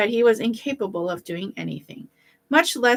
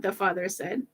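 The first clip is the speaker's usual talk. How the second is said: usual speaking voice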